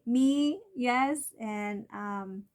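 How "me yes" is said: eager reply. Pretend.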